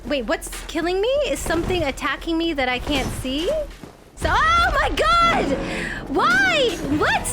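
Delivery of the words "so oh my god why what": high, loud, frantic cries; panicked shouting. Real, not acted. Gamer being killed by something unseen, panicked shouting. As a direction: yell in rising panic as it attacks. Panic as character gets attacked.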